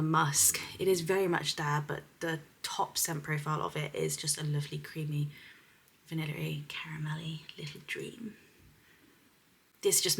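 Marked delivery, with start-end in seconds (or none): 1.9-8.3 unvoiced whisper, savouring each word; soft whisper. Vlogger describing perfume to viewers, intimate whisper. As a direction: whisper it like a secret treat.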